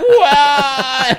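loud high laughing fit; burst of laughter. Real, not acted. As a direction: crack up loudly at the memory. Laughing hard at own story.

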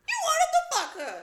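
high, loud, laughing accusation; laughing shout. Real, not acted. Calling out the man who hit on her mom, loud and laughing. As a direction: yell it out, half laughing.

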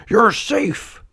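high, drawn-out relief; acted high-voiced relief; relieved call. A narrator's reading. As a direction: exclaim with joy at a found child. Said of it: "called Geppetto".

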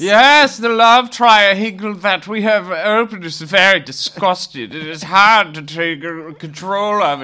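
high, loud, slow put-on accent, drunkenly grand; Heinrich impression. Podcast host mocking the dancing-drunk Heinrich, hammed-up accent. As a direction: play the swaying eccentric with a thick foreign lilt. Mimicking eccentric Heinrich character.

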